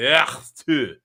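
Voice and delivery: throaty hawk-and-spit "Ah, tu"; mock loogie hawk. Shown as a demonstration. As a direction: hack it up like spitting a loogie. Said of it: "pulling up the loogie and then acting like you're spitting it".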